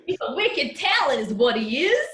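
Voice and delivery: put-on high, loud Boston accent sliding into Australian; cartoon-squirrel take on a Boston accent; bad Boston accent. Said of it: "Why did that become Steve Irwin at the end?".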